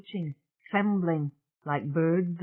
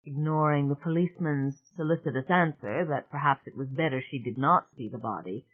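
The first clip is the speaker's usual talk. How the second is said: ordinary delivery, nothing marked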